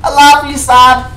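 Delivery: excited. Acted. Mock tender to game son.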